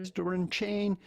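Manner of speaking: ordinary talk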